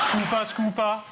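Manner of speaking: teacher's exaggerated Geordie; Geordie accent. Shown as a demonstration. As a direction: model it in broad Newcastle accent. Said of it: "do the Geordie accent".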